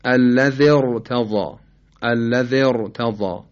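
الذی ارتضی with a heavy, full r. Shown as a demonstration. Tajweed teacher demonstrating tafkhim, heavy r in الذی ارتضی. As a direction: slowly recite الذی ارتضی, thickening the r.

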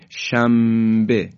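شنبه drawn out slowly as "shambe" with m. Shown as a demonstration. Tajwid teacher drawing out شنبه with m. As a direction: stretch شنبه, letting n become m.

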